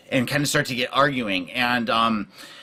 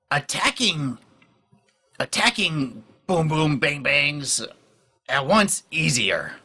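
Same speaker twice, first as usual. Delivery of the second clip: slow, pidgin goblin voice, often pitched up; Shaky, scheming goblin; 3 semitones higher, much slower than usual. Acted. Goblin advising allies on attack plans, slow and deliberate. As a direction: think it through slowly in broken speech. Game master speaks as Shaky.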